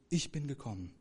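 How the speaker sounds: usual speaking voice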